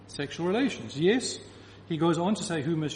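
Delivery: usual speaking voice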